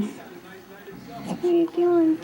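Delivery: stretched-out cooing question to her newborn; drawn-out coo. Real, not acted.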